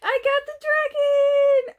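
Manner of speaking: high, loud, slow squeal of joy; excited squeal. Real, not acted. Finally getting the wanted dragon, squeal. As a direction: shriek with delight. Finds the dragon she hoped for.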